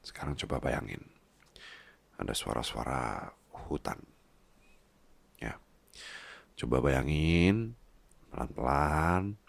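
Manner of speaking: soothing guided imagery. Acted. Guiding partner to imagine.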